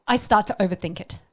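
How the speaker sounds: ordinary talk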